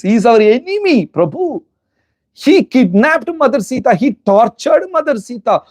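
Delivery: high, pressing protest at his brother; indignant outburst. Retold or imitated. Lakshmana begs his brother to kill Ravana, high and indignant. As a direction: demand the enemy be killed, outraged.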